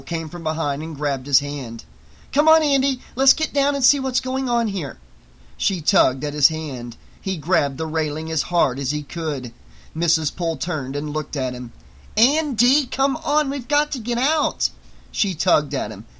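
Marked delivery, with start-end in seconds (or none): 2.3-5.0 high, raised voice of a matron; hurrying woman. A narrator's reading. Missus Poole hurrying Andy downstairs, high urging voice. 12.2-14.7 high-pitched, insistent matron; urgent woman. A narrator's reading. Missus Poole tugging Andy towards the exit, high and urgent. Woman tugs reluctant boy.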